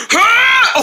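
high startled scream. Acted. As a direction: shriek in fright. Falling scream.

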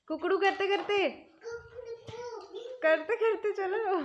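giggling while giving the order; laughing. Real, not acted. Teacher telling the girl to crow while walking, laughing. Teacher amused by crowing walk.

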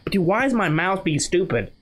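ordinary talk

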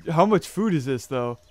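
normal talking voice

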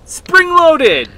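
very high, loud yell; screaming while falling. Real, not acted. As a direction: yell the jump out. Shout during the jump.

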